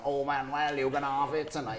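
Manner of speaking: put-on Welsh Valleys girl accent, teasing. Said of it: "it was also a spot-on accent as well".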